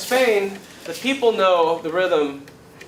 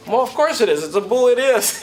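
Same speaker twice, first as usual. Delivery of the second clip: higher-pitched, mock-defensive comeback; indignant protest; 6 semitones higher, faster than usual. Young hotshot argues with his flamenco teacher, indignant. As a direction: imitate a cocky student insisting he's right.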